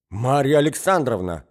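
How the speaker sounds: loud indignant call; young man shouting at the hostess; shouting her name. A narrator's reading. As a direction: cry out her name in protest. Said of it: "кричал Мозгляков".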